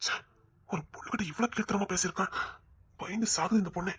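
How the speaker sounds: whispered disgust; hushed disgusted aside. Acted. Disgusted aside about villain.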